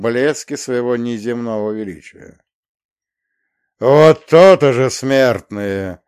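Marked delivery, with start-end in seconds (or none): 3.8-6.0 booming archangel voice, much louder; deafening thunderous voice. Acted. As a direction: boom it down at mortals from above. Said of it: "оглушительно громовой голос".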